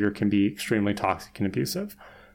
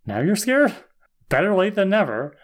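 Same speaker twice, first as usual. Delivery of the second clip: mock taunt; 7 semitones higher than usual. Buffy to the hyperventilating demon, mock taunt. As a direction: lightly taunt a cowering foe. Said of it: "And Buffy's like".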